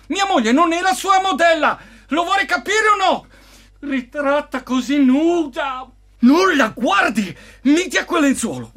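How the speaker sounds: angry shouting. Acted. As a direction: shout at him in jealous rage. Jealous husband confronts painter.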